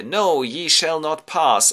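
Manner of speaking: slow, loud stern command; commanding refusal. Acted. Ulysses bars the ghosts from the wine, loud and slow. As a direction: plant your feet and forbid them.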